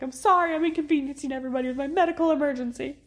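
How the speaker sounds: frantic apology. Retold or imitated. Mother apologizing to birth team, high rushed apology. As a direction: gush out sorry in a rush. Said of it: "kept apologizing".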